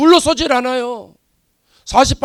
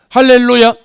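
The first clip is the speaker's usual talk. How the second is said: a ringing, fervent cry; loud hallelujah; 11 dB louder than usual. Real, not acted. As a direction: shout it out to the congregation. Preacher's acclamation.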